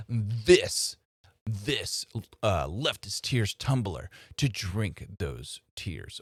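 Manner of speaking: slow, mock-smug ad pitch; lightly voiced sarcastic pitchman, dragging the words; Ben Shapiro ad-read voice. Vlogger mocking a pundit's ad reads, slow sarcastic pitch. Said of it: "he talks like this".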